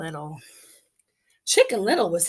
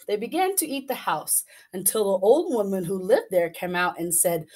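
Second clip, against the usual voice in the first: plain reading voice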